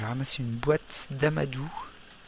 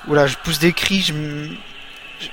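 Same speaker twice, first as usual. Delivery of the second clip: louder, rushed and rattled; blurting it out as the game spins out of control; real alarm; 2 semitones higher, 7 dB louder than usual. Real, not acted. Personnage hors de contrôle, panique. (panique réelle, beaucoup plus fort)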